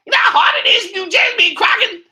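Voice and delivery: very high, loud, fast squawk; put-on shouting voice. Shown as a demonstration. Creator showing how he has to talk, high shouted voice. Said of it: "I gotta talk like that".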